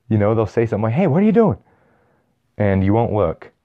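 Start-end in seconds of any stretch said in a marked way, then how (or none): 0.9-1.6 high-pitched mock indignation; startled protest. Toucher objects to the arm grab, protesting in surprise. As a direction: shoot up high, objecting to being grabbed.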